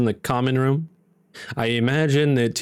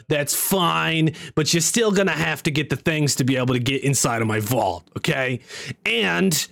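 insistent comic wizard in a stone; imperfect comedian impression, laying down rules; Gilbert Gottfried. Wizard telling Barlow the vault's terms, imitating Gottfried. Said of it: "sounds like Gilbert Godfrey".

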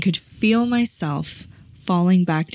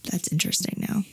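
a quiet aside to yourself; whispered aside; 8 dB quieter, faster than usual. Real, not acted. Stepping out of the reading, whispered aside. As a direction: whisper it under your breath.

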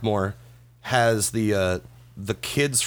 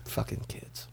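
very soft, nearly voiceless grumble; muttered aside; 15 dB quieter, slower than usual. Real, not acted. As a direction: mutter it under your breath. Muttered aside about the kids.

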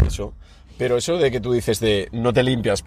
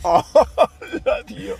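high, mock excited greeting; 10 semitones higher, much slower than usual. Real, not acted. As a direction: squeal a silly hello.

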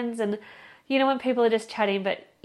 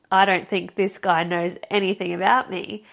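usual speaking voice

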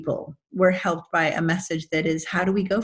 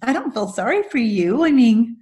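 teasing husband; 4 semitones higher, 6 dB louder than usual. Retold or imitated. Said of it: "He kind of rolled his eyes".

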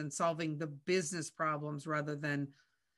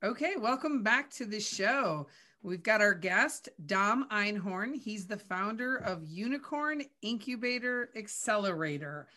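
raised, projected host voice; show host introduction; 5 semitones higher, 4 dB louder than usual. Acted. Host welcoming listeners back from the break, projected announcer delivery. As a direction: announce the guest to the audience.

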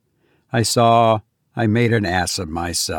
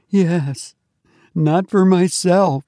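put-on high young woman's voice, fearful; a scared girl confessing her worry; Tessie, frightened; 7 semitones higher, slower than usual.

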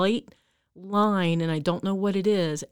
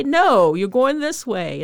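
sharp, loud no, then a slower firm command; scolding the dog; 5 semitones higher, 6 dB louder, slower than usual. Retold or imitated. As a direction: boss the tugging dog back onto your path, loudly.

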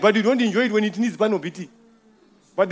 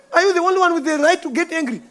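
higher, fast, indignant challenge; higher indignant retort; 7 semitones higher than usual. Retold or imitated. To the angry customer, finally answering back indignantly. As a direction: fire the question back, offended. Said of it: "you know what I wanted to ask him".

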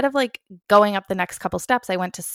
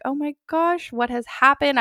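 shocked realization; 7 semitones higher than usual. Retold or imitated. Discovering she is covered in blood.

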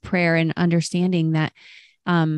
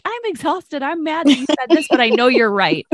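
a hint of a grumbling parent, higher pitched; mock grumpy parent; 6 semitones higher than usual. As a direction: play a tired parent half-grudgingly admitting it. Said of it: "the other ones are like".

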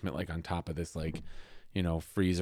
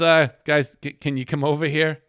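raised, louder, hesitant call to colleagues; uneasy calling out; 6 semitones higher, 8 dB louder than usual. Retold or imitated. Detective to crime scene guys at the freezer, uneasy call. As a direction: call them over with a nervous stammer.

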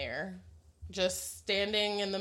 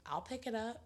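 creepy side-eye; 2 semitones higher, 10 dB quieter than usual. Retold or imitated. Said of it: "with this creepy ass side eye".

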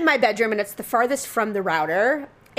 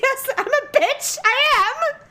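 very high, drawn-out squeal; mock confession; 13 semitones higher, slower than usual. Woman owning up to her laughing coworkers, high mock confession. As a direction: squeal a comic admission.

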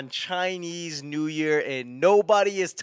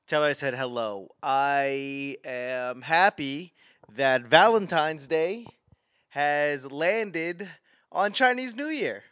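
ordinary talk

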